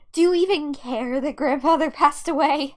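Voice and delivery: loud, accusing question; angry challenge. Acted. Daughter confronting dad about grandfather, angry challenge. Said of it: "made me feel so angry".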